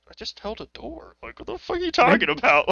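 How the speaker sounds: high-pitched, incredulous retort; hammed-up, high-voiced disbelief; mock bafflement. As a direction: act baffled by the praise. Acted baffled reply to old lady.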